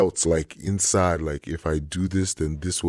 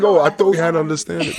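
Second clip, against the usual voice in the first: surprised; 11 semitones higher, 8 dB louder, faster than usual. Retold or imitated. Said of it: "I'm like".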